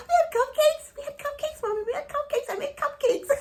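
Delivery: put-on high bright little-girl voice, proud and eager; childlike proud voice. Daughter proudly telling Mommy she ate cupcakes, squeaky child voice. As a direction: boast like a small child caught out. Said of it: "I said".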